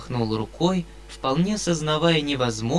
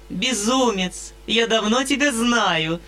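ecstatic playful babble; 8 semitones higher than usual. A narrator's reading. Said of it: "с восторженной игривостью пролепетала".

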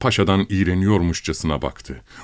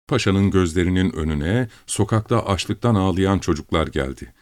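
usual speaking voice

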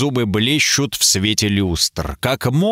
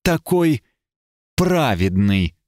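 venomous; slower than usual. A narrator's reading. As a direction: stretch "праведный" with poisonous scorn. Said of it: "обронила Дженнифер ядовито".

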